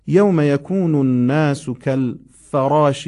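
کالفراش said kælferɑʃ with a clear e after ف; slow and loud, stress یوم; correct vowel. Shown as a demonstration. Teacher warning about a misread vowel in کالفراش.

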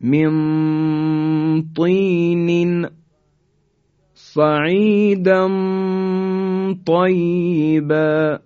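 slow chanted من طین صعیدا طیبا; Quran recitation. Shown as a demonstration. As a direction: recite slowly with heavy ط.

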